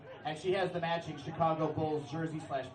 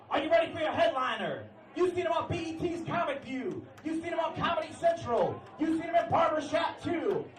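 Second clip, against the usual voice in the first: high shouted emcee intro; club host hype; 10 semitones higher than usual. Retold or imitated. Club host introducing the headliner, high hype shout. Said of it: "the host was like".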